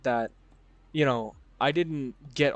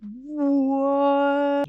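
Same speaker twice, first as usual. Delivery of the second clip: high, loud, drawn-out "what"; mock disbelief; 12 semitones higher, 5 dB louder than usual. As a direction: stretch out a squealed, put-on shock. Acted disbelief at recording a video.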